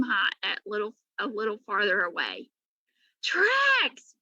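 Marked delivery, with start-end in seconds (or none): 3.2-4.1 high, falling cry of discovery; sudden high shout; excited call. A narrator's reading. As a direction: call up from below with a find. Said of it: "she called up".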